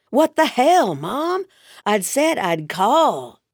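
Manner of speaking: loud, high "What the hell" dropping to a slow pointed "call"; exasperated outburst. Acted. Son caught by his mother, exasperated and loud. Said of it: "Cal emphasized the word call".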